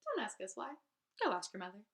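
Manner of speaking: ordinary delivery, nothing marked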